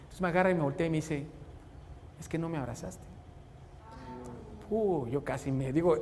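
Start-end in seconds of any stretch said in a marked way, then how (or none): 2.2-3.1 soft, hurt little voice; much softer, a child's hurt reproach. Retold or imitated. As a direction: say it softly, like a wounded child. Hurt son explains why crying.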